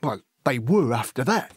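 lively, wide-eyed working-class lad spinning war tales; excitable storytelling with big pitch leaps; eager young Cockney recruit. Acted. Horace telling Elsie gruesome war stories, eager and excitable.

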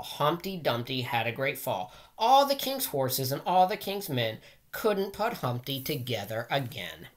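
ordinary delivery

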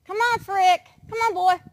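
high loud call to a pet; calling the cat. Real, not acted. Owner calling the cat to come closer, high and loud. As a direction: call the cat over brightly.

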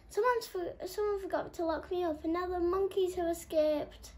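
high, anxious telling; lion lock warns the monkeys escaped; worried lion. A narrator's reading. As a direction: blurt out the bad news. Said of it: "Mortis, the lion-shaped lock".

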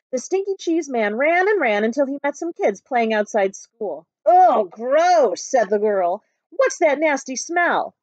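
put-on lively read-aloud for children; story narration. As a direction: tell it like a picture book to a class. Teacher reads picture book aloud.

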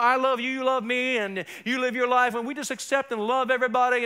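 mock sing-song cheer; Barney song mockery. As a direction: tease with a kids' song lilt. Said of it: "big Barney in the sky".

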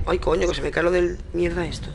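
ordinary delivery

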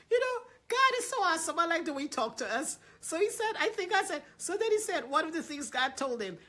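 high, loud and fast; excited. Real, not acted. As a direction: gush with delight. Excited aside after laughing.

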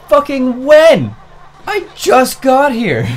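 high, loud, drawn-out protest; exasperated retold shout, stretched words; incredulous yell. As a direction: shout it slowly in total disbelief. Retold indignant retort to accuser.

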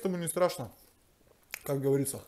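usual speaking voice

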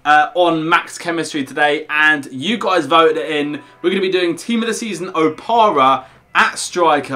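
bright high-energy welcome to viewers; hyped YouTube intro. Acted. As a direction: pump up the audience like a YouTuber.

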